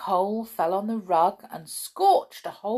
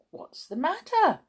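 high, eager gossip voice; chatty neighbour asks the upset man what's wrong; nosy gossip; 10 semitones higher than usual. Acted. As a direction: lean in like a busybody. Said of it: "who always loved to chat".

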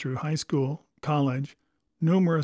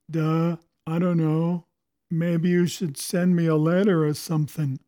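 dopey answer; 4 dB louder than usual. Pretend. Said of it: "We might say".

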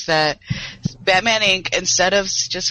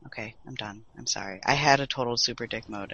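much softer, lower after the rant; quiet wind-down; 6 semitones lower, 10 dB quieter than usual. Real, not acted. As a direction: let the energy drop away.

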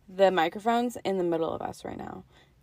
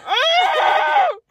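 high loud laughing shriek; jumping at the news of a car; startled yelp; 10 dB louder than usual. Retold or imitated. Startled when told about car.

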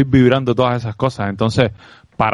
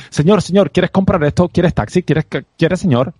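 pushy street vendor; 6 semitones higher than usual. Retold or imitated. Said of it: "ese acoso arriba de mí".

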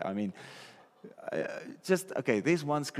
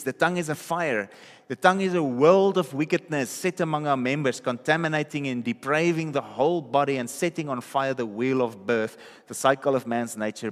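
loud, driving scripture reading; forceful preaching; 2 semitones higher, 6 dB louder than usual. Acted. Preacher reading James to the congregation, loud and forceful. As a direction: thunder the verse at the church.

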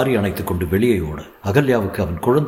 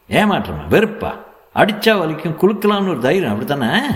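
accusing doctor; 7 semitones higher than usual. A narrator's reading. Doctor accusing mother of shaking the baby, mounting angry scolding. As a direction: pitch climbing with each accusation.

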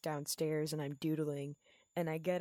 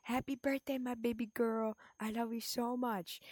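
mock doting dad; 7 semitones higher than usual. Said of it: "he's all like".

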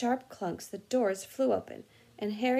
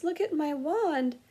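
shaky voice; 6 semitones higher, 4 dB louder than usual. Acted. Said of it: "said Ron in a shaky voice".

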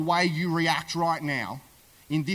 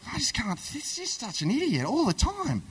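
high, wavering wail of exasperation; hammed-up despairing complaint, pitch swooping; mock exasperation; 7 semitones higher than usual. Wife moaning about her idiot husband, mock exasperated.

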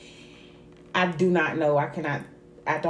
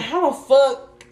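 slow loud disbelief; incredulous; 8 semitones higher, 9 dB louder, much slower than usual. Retold or imitated. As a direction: drag it out, stunned. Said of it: "I was like".

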